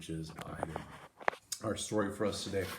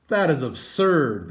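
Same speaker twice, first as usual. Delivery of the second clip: mildly indignant workers; 7 semitones higher, 16 dB louder than usual. Acted. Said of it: "they said".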